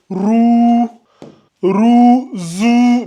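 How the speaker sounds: long, held "ou" on roux and zou; voyelle allongée. Shown as a demonstration. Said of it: "on l'allonge en deux secondes". (voyelle « ou » tenue longuement dans roux, zou)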